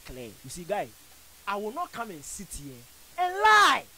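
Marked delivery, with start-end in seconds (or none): 3.2-3.8 1 loud insistence. Real, not acted. Heated claim on the radio.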